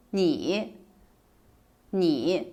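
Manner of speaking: "你" dipping low, then lifting; third tone. Shown as a demonstration. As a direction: let "你" sink and come back up. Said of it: "the third tone".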